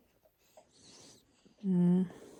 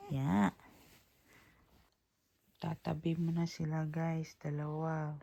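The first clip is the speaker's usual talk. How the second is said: ordinary delivery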